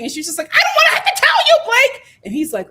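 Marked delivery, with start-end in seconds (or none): 0.5-2.0 high, fast shouted retort; retelling Haley screaming at Blake, shrill and fast; mock yell. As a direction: yell it at him, exasperated.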